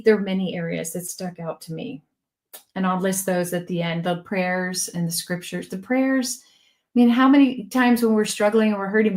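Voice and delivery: normal talking voice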